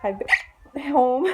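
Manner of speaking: talking through hiccups; hiccuping mid-sentence. Real, not acted. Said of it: "Sorry, I have hiccups again".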